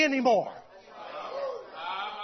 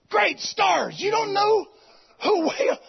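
higher, exclaiming in disbelief; mock astonishment; 6 semitones higher than usual. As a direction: act out being shocked.

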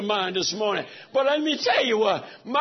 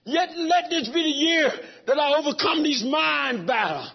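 higher, crying-out preacher's plea; preaching at full force; 6 semitones higher than usual. Real, not acted. Preacher crying out to God before the congregation, fervent. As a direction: cry it out to the Lord with fervor.

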